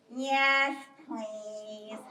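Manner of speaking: drawn-out, fading turtle reply; slow turtle. Acted. Turtle accepting bear's help, slow and meek. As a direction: answer slowly, sinking softly away. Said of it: "And Turtle says".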